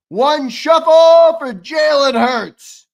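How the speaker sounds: drawn-out hype shout; hype announcer. Real, not acted. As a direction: announce it to the whole stream. Announcing the next shuffle.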